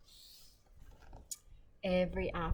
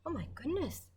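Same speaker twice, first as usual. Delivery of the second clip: surprised; 9 semitones higher than usual. Acted. Neighbour surprised in story.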